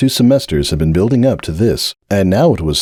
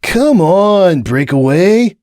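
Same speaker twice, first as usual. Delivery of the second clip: drawled; 10 semitones higher, 4 dB louder, much slower than usual. Acted. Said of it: "Hoops drawled".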